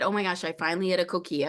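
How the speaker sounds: ordinary talk, nothing marked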